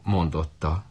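ordinary delivery

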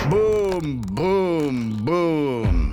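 loud, high, long bangs; drawn-out falling impact noises; furious booms. A narrator's reading. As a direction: boom out three crashing blows. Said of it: "s döngötte dühösen".

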